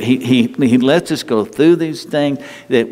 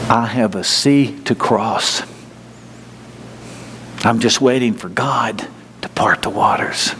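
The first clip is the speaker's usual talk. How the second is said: slow, hushed to a whisper; slow soft affirmation; 2 semitones lower than usual. Shown as a demonstration. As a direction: say it to yourself, slowing into a whisper. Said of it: "tell yourself and remember this".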